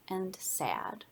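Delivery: whispered, sad and small. A narrator's reading. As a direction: let the word fade into a sad whisper. Shape lonely and sad.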